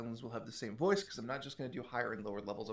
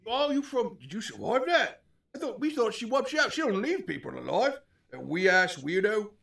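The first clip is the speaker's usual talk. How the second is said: hobgoblin voice; 9 semitones higher, 6 dB louder than usual. Acted. GM hobgoblin voice continues.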